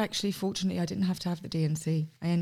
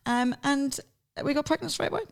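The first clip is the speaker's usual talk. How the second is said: usual speaking voice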